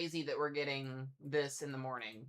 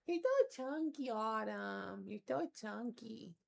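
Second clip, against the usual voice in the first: higher, slow cooing pet voice; 5 semitones higher, slower than usual. Real, not acted. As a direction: coo at the chubby cat. Baby talk to cat.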